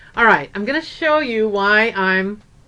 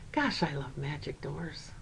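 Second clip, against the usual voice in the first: put-on much quieter, a child's dreamy murmur of wonder; hushed awe; 7 semitones lower, 17 dB quieter than usual. Four-year-old walking along says it to nobody, hushed wonder. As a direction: say it softly to yourself like a little boy.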